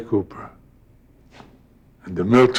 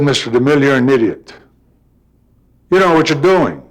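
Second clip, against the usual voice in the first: raised, heated retort at the director; mad retort; 5 semitones higher, 3 dB louder, faster than usual. Retold or imitated. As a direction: snap back, voice rising on don't. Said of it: "I suddenly got very mad".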